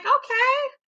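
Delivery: high, delighted "Okay, get it"; happy cheer. Retold or imitated. Host cheering the two women making up, high and pleased. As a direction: cheer them on brightly. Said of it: "I was like".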